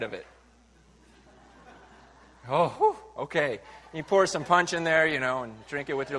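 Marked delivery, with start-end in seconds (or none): 2.5-3.6 mock relief. Imagined relief after washing cup.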